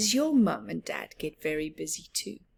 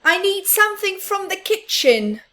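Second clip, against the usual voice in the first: hurried little mouse; 12 semitones higher, 10 dB louder than usual. Acted. Little Mouse refusing Big Mouse while busy, high squeak. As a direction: squeak it out in a rush.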